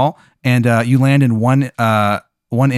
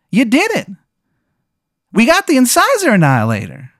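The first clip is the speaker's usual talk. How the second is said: very high-pitched cartoon character voice; high put-on mascot voice; Tim the Tooth; 12 semitones higher than usual. Tim the Tooth speaks in game story.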